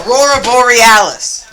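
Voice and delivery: loud mock documentary host; loud narration. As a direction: announce the llama grandly. Narrator repeats llama's name.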